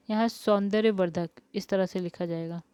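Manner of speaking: usual speaking voice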